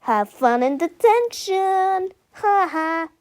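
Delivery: high, slow gloating singsong; Melvin. A narrator's reading. Melvin gloats over the boys' detention, smugly taunting. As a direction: taunt them with a smug mock laugh. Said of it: "grinning smugly".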